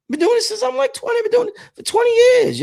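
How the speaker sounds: excited shout. Real, not acted. Hyped vlogger shouting his twenty years to fans. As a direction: belt the boast out.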